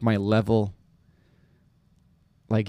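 normal talking voice

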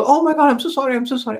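high, hurried-sounding retold apology; flustered apology. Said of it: "you should have seen that lady's response".

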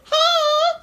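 very high, loud little-creature voice; Xavier. Acted. Co-host voices his tiny companion greeting him, high squeaky voice. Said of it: "he's like".

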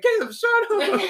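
high, laughing shut-up; laughing snap. Retold or imitated. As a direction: brush him off through laughter. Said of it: "She's like".

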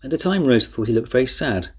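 usual speaking voice

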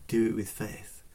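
ordinary talk

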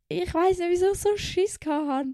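high, whiny squeak; squeaky whine. Acted. Admitting her fear to viewers, high whiny squeak. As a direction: squeak it out like a scared kid.

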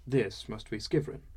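ordinary delivery

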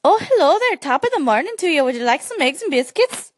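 high, bouncy Irish lilt; chirpy Irish host voice; Irish accent. Shown as a demonstration. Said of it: "Is that Irish?".

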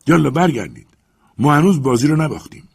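high, loud excited rallying call; eager command. A narrator's reading. Legrand rallies his companions back to the tree, eager command. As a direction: urge them back with new hope. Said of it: "سپس گفت".

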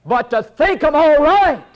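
high pulpit voice, building in force; forceful preaching. Real, not acted. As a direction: preach it harder with each phrase.

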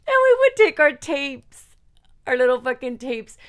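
high, loud, through laughter; real giggly outburst; laughing. Real, not acted. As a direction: squeal it out while cracking up. Still laughing at her memory.

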